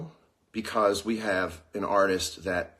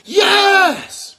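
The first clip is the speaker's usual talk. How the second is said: a huge, much higher and louder shriek of yes; screamed yes; 11 dB louder than usual. Retold or imitated. Host on the treadmill thrilled by the rhyme, screaming. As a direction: scream it in pure delight. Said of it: "and I screamed".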